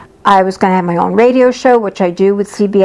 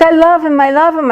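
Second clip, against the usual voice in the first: high, plaintive lovesick cry; mock lovesick wail; 7 semitones higher than usual. Heartbroken client wailing over her boyfriend, mock sobbing. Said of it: "She was like".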